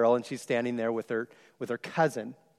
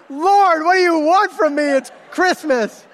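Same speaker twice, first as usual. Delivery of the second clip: much higher and louder, comic pleading to heaven; mock exasperated prayer; 13 semitones higher, 11 dB louder than usual. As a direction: throw up your hands at the Lord. Exasperated aside to God.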